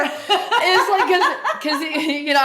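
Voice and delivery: high, loud laughter through words; cracking up at the irony; giggling between words; laughing. Real, not acted.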